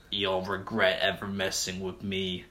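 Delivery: a hint of a menacing villain; villain threat. Villain vows revenge.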